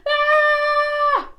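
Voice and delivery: high loud pretend falling scream. Shown as a demonstration. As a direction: show the kids a falling scream. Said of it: "Can you pretend to be this person".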